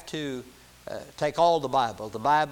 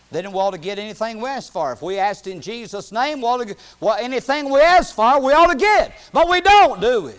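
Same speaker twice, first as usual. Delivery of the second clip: building preaching; 7 semitones higher, 11 dB louder than usual. Real, not acted. Preacher challenging the church on asking in Jesus' name, building preaching. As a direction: climb to a high, loud pulpit cry.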